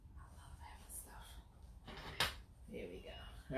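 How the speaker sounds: unvoiced whispered aside; whispering. Real, not acted. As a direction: whisper it under your breath.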